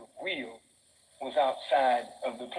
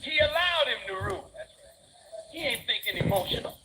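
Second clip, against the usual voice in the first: fiery preaching; 9 semitones higher, faster than usual. Acted. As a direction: preach it to the back row. Preaching at full force.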